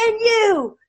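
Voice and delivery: high, pointed blame; accusing dad. Retold or imitated. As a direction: wheel round and jab the words high. Dad turns on older sister.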